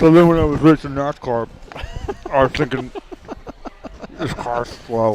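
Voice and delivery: talking through a mouthful; words round a bite of food; mouth full. Pretend. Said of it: "spoke with his mouth full".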